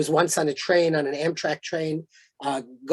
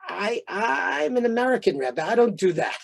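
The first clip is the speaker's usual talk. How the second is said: demur archly, stretching the first words; imitating an arch, playful refusal; 5 semitones higher than usual. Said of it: "looked at me in this kind of arch way".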